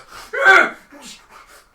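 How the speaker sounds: loud, high-pitched creature laugh; high monster cackle. Acted. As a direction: cackle like a gleeful goblin. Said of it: "And the other guy goes".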